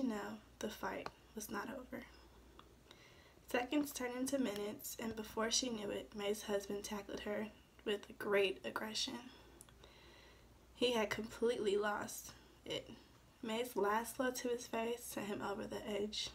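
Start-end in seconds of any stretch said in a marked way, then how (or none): none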